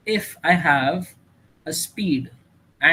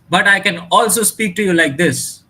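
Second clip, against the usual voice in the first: raised, projected loud voice; much louder, emphatic delivery; loud demo; 3 semitones higher, 7 dB louder than usual. Shown as a demonstration. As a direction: belt it out to show loudness. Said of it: "The loudness changed".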